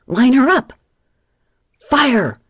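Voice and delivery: high, loud battle cry; pirate captain hollering orders. Acted. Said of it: "Ginger hollered".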